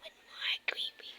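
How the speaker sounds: soft, low spooky whisper; creepy whisper. Pretend. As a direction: breathe it out like a secret. Kids whisper creepy lines.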